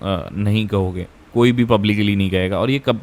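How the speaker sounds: ordinary talk, nothing marked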